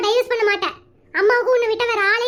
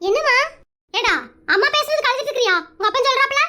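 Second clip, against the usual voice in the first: normal talking voice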